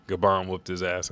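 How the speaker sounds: ordinary talk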